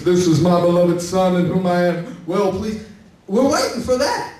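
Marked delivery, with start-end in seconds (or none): 0.0-2.8 voice of God. Acted. Quoting God's voice to Jesus.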